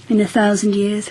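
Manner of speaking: ordinary talk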